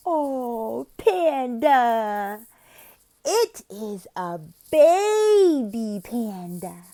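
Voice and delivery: put-on teacher to small child. Shown as a demonstration. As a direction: marvel word by word for a child. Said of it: "You'd be like".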